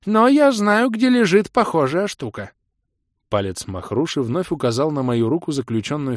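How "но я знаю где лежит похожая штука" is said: put-on high, loud singsong old creature. A narrator's reading.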